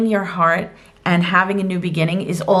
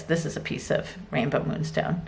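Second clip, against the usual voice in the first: whispering; 3 semitones lower, 10 dB quieter than usual. Real, not acted. Showing a crystal to camera.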